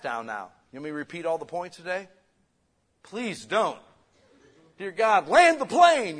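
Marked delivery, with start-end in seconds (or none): 3.0-3.8 mock plea. Congregation dreading repeated points. 4.8-6.2 much higher and louder, a hammed-up plea to God; mock desperate prayer. Listener begging God to end the sermon, hammed-up desperate plea. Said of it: "that's what you do".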